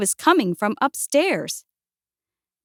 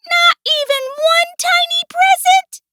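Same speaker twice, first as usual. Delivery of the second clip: very high, loud squeak; squeaking mouse; 8 dB louder than usual. A narrator's reading. Mouse pleads with Bear for a present, loud squeak. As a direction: plead in a shrill little mouse voice. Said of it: "squeaked Mouse".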